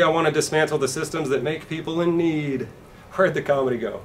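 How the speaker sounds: normal talking voice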